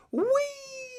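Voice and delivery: very high squeal of fun; gleeful whee. Retold or imitated. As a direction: push the wheelchair with glee.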